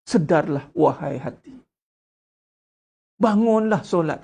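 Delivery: slow, drawn-out tearjerker delivery; mock soulful plea. Shown as a demonstration. Preacher parodying a flowery sermon, in a mock soulful plea. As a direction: lay the emotion on thick, mockingly. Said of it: "Tak payah".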